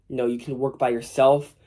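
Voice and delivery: ordinary talk, nothing marked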